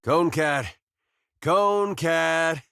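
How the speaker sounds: high, drawn-out coaxing call; sing-song animal call. Acted. Man calling his cat, high and coaxing. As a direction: call the critter over, stretching the name.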